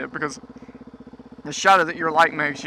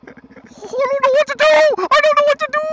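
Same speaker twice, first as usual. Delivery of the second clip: panicked timid driver. Pretend. Mocking a timid driver who blocks the road, high panicky wail. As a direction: squeal it like a lost, scared driver. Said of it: "mocking a timid driver".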